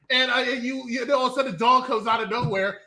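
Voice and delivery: usual speaking voice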